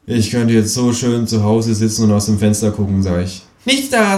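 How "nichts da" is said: high cheeky kangaroo. Acted. Kangaroo brushing off the complaining narrator, high and cheeky. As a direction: snap back high as the know-it-all kangaroo.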